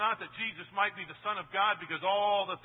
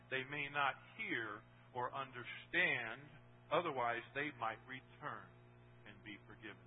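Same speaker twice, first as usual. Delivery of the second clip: fading to a hush; 5 semitones lower, 6 dB quieter than usual. Acted. Verse read, fading to hush.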